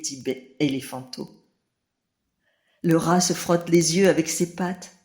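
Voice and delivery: ordinary delivery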